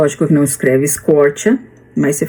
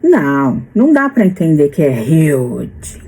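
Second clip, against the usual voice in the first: ordinary delivery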